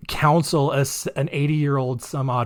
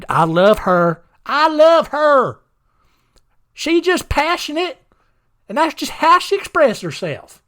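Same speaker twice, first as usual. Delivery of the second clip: exaggerated high-pitched Southern simpleton; dim-witted Southern accent; 7 semitones higher than usual. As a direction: play a clueless, devoted husband with a hammed-up Southern accent. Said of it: "I equate a Southern accent to stupidity".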